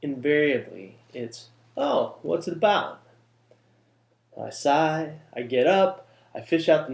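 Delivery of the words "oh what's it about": lightly voiced asker, slow and higher-pitched; slow, lifted "oh" of a curious listener; imitated curious questioner. Party guest asking a writer about his book, imitated curiosity. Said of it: "What's the first question out of every mouth?".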